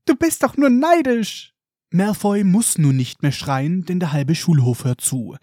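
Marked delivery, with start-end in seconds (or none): 0.0-1.5 high piping, slow and indignant; squeaky. Acted. Colin defends Harry against Malfoy, piping high. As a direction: squeak it out like a tiny boy.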